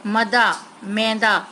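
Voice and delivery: मदा short, then मैदा with long ai, slowly; wrong then right word. Shown as a demonstration. Teacher contrasting the wrong and right word for pupils, slowly. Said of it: "मदा कुछ नहीं होता".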